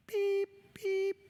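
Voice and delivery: playfully imitating a machine beep; mock beep. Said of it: "and I'm like".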